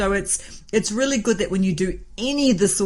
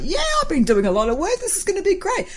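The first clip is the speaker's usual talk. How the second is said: cocky; 12 semitones higher, faster than usual. Retold or imitated. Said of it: "And I'm like".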